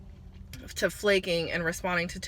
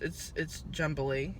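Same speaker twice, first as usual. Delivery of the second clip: stumbling over words; halting, stuttered speech; stuttering; 4 semitones lower, 7 dB quieter, slower than usual. Real, not acted. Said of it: "and I'm stuttering".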